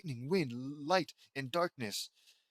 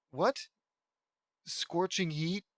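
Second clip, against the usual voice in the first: incredulous; 3 semitones higher, slower than usual. Real, not acted. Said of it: "is that not fire".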